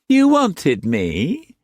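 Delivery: high, slow, cloying; high, slow, sugary madam entering the room. A narrator's reading. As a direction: drip honey on it. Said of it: "she asked even more sweetly".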